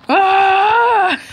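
high, loud, held cry; loud wailing scream. Real, not acted. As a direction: wail out in despair at the game. Screams in game frustration.

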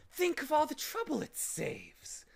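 high, loud gleeful villain; evil glee. A narrator's reading. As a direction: say it through an evil grin. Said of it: "grinning an evil grin".

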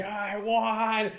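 high, slow drawn-out victory cry; gloating fighter. Retold or imitated. Palhares celebrating too early, hammed-up. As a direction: revel in a fake win.

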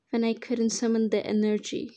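ordinary delivery

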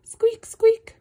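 shrieking squeak of a mouse. Acted. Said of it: "Mouses say squeak".

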